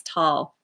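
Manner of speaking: Tolle said as one syllable, like "tall"; corrected pronunciation. Shown as a demonstration. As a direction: say Tolle with a silent final e. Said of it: "look up the pronunciation of his name".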